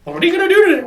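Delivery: very high, loud sing-song morning chirp; mock her bouncy wake-up energy; perky girlfriend. Voicing chipper girlfriend again.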